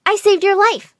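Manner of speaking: high, proud announcement; pleased with oneself, pitched high. A narrator's reading. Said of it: "I proudly turned to the twins".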